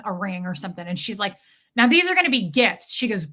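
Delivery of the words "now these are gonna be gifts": loud bossy announcement; demanding customer. Retold or imitated. Rude customer instructing the clerk, loudly bossy. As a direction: announce it like an order. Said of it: "I'm literally quoting this woman".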